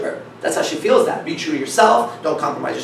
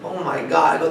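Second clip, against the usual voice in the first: ordinary delivery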